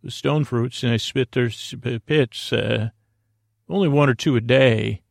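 normal talking voice

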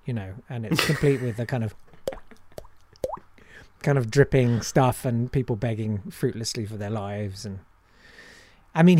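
usual speaking voice

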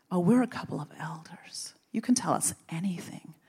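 conspiratorial whisper, mock-confiding; coaxing whisper. Elders coaxing her to share gossip, confidential whisper. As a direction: whisper it like an elder fishing for secrets.

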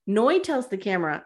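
ordinary talk

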